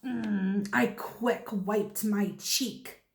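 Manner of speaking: usual speaking voice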